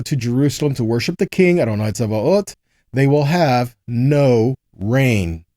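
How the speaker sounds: ordinary talk, nothing marked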